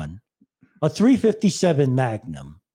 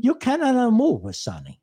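put-on Italian accent; 8 semitones higher than usual. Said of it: "I like my Italian accent".

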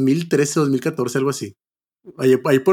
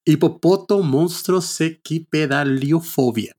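slow spelled-out word; 3 semitones higher, much slower than usual. Shown as a demonstration. Host savors the absurdly long phobia name, very slowly. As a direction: sound out each piece of the word slowly.

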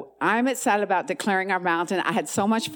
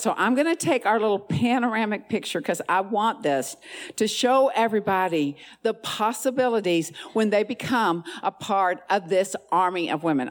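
ordinary talk

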